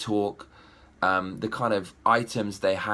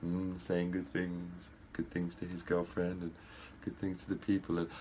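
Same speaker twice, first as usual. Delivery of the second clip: mocking the bland prince; 5 semitones lower, 11 dB quieter than usual. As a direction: mimic a prince with no spark, barely above a mutter. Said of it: "He just walks around like this the whole time".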